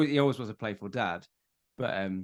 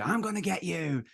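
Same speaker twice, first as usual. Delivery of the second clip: higher, playful mock threat; teasing granddad; 9 semitones higher than usual. Granddad winding up his grandson, playful high teasing. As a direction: wind up a small child. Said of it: "my dad would just wind him up".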